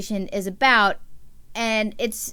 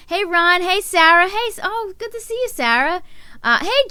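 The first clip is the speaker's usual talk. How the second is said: normal talking voice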